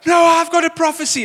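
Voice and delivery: high, loud interrupting shout; mock shouting. Preacher acts out worshipper butting in, shouting over another. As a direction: shout over someone as if you can't wait. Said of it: "just starts shouting over her".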